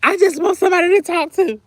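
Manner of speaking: playful whining about being alone; mock whine. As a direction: pitch it up like a lonely child. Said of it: "I'll be like".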